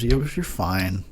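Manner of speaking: ordinary talk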